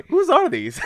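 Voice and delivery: high giggling question; laughing. Real, not acted. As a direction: laugh through the words. Laughing at the movie mix-up.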